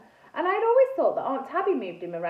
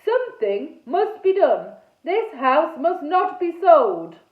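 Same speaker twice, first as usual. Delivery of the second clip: slow, loud, raised proclamation; booming loudly; 5 semitones higher, 9 dB louder, slower than usual. A narrator's reading. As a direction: declare it slowly in a knightly boom. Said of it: "he boomed so loud".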